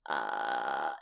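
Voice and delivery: low creaky fry sound; creaky door vocal fry. Shown as a demonstration. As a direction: clench the throat and creak low. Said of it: "a clenching sound that starts".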